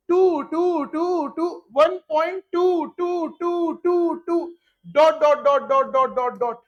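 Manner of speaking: chanting the repeating digit for students; endless repeating. Shown as a demonstration. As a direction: drag the टू टू टू on and on like it never stops. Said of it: "repeat कर रहा है बेटा".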